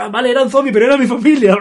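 whiny complaint cut by a laugh; upset Morty voice. Retold or imitated.

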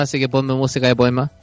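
usual speaking voice

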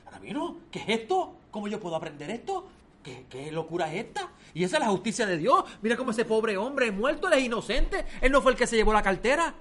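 high, rising, increasingly loud outrage with a laugh of disbelief; alarmed protest. Acted. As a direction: plead in shocked disbelief. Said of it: "el muchacho alarmado".

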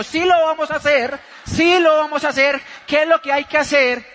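high, loud turnaround; acted eager surrender. Husband suddenly agrees with his wife, loud and eager.

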